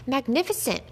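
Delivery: an entertainer cheering the dog; cried out. A narrator's reading. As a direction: exclaim in delight, pitch lifted. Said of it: "cried the famous entertainer".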